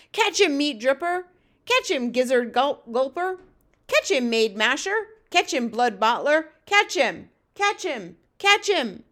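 loud, high game calls, one after another; giants shouting. Acted. Giants shouting during throwing game.

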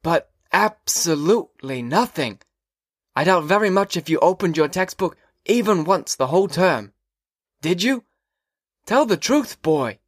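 put-on a fussy old teacher pressing the boy. A narrator's reading. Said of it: "Then he said it three times".

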